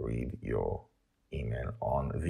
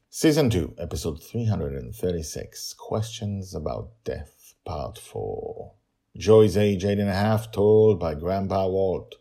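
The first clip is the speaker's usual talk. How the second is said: raised announcer voice; episode title read; 4 semitones higher, 6 dB louder than usual. Acted.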